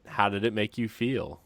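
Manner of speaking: ordinary talk, nothing marked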